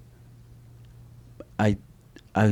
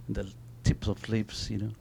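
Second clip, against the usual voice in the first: ordinary talk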